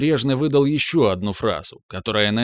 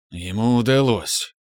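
ordinary delivery, nothing marked

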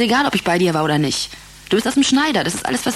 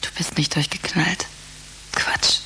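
whispered reassurance; 3 semitones lower, 6 dB quieter than usual. Acted. Comforting him softly.

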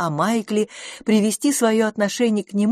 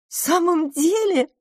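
high, drawn-out curious question; interested; 12 semitones higher than usual. A narrator's reading. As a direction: ask with keen interest, pitched very high. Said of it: "заинтересованно сказала мисс Лафос". (заинтересованно, высоким голосом)

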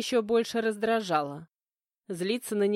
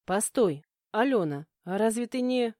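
usual speaking voice

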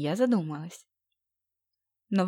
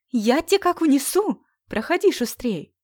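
excited; 7 semitones higher, 7 dB louder than usual. Acted. Playful threat, eager welcome.

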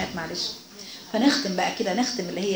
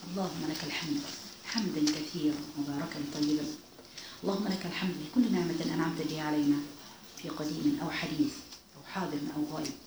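prayer; 6 semitones lower, 11 dB quieter than usual. Acted. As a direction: lead the closing prayer gently and devoutly. Leads closing dua. (دعاء بصوت خافت وخاشع)

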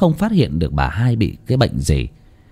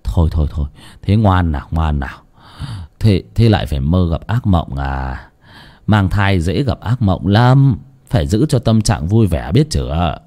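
chồng vỗ về vợ; soothing husband; 4 semitones lower than usual. Acted. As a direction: hush her like a frightened child. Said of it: "mà vỗ về".

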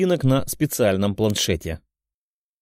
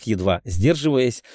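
ordinary delivery

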